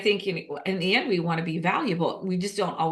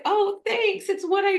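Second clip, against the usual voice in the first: much higher, playful fake modesty; mock modest; 13 semitones higher than usual. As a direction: shrug off the praise with a jokey high voice. Mock modest reply to praise.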